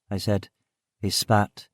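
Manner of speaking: plain reading voice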